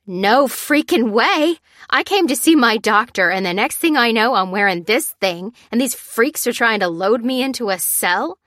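high, loud, outraged woman; irate captive patient. A narrator's reading. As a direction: protest indignantly at being held captive.

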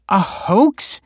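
high, loud disbelief; stunned question; shocked. A narrator's reading. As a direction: echo the word in disbelief. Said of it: "said the woman, her eyes widening".

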